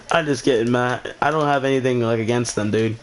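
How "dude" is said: ordinary talk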